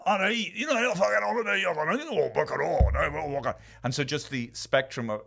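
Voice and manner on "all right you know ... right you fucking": put-on high Scottish accent sliding toward the Yakuza voice; Scottish to Japanese accent. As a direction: morph the Scottish accent step by step. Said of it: "the accent changing from".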